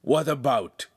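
mildly suspicious query. A narrator's reading. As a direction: ask with mistrust. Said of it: "Ugolino asked suspiciously".

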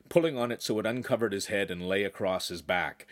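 ordinary delivery

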